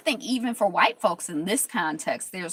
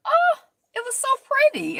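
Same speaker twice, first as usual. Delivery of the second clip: much higher, delighted squeal; high gushing; 5 dB louder than usual. Retold or imitated. Watching the curls set free, squealing high. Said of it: "and I was like".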